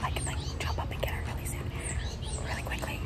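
hushed stakeout whisper. Real, not acted. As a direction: whisper so nobody hears. Hiding outside, whispering on stakeout.

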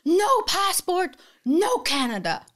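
imitating a furious customs agent; angry official. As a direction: bark the rule at her. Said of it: "he's, like, really mad".

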